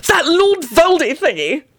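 slow, high-pitched gleeful voice; drawn-out high delight. A narrator's reading. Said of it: "he announced with glee".

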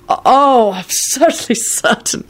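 high, slow flustered reply; stammering. A narrator's reading. Charlie assuring Kingsley, stammering. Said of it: "Charlie stammered".

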